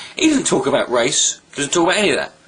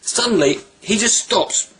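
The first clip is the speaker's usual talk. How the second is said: ordinary talk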